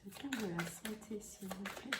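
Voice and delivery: very soft, under the breath; muttered aside. Real, not acted. Tarot reader asking for the health card, muttered very softly. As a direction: ask it quietly, almost to yourself. Muttered request while drawing cards.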